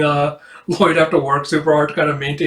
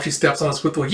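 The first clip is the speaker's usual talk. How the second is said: usual speaking voice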